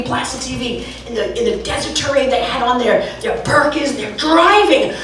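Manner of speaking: ordinary delivery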